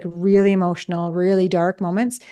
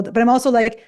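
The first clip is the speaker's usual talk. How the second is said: ordinary delivery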